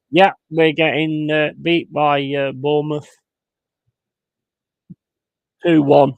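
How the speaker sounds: telling chat the bad news; frustrated; loud and fed up. Real, not acted. Fan telling chat the score.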